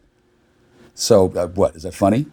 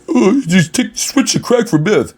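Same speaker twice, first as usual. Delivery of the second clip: high, mocking put-on voice; 11 semitones higher, slower than usual. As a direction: quote him in a high sneering voice. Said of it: "He's like".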